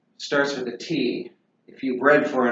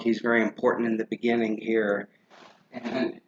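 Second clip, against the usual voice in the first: usual speaking voice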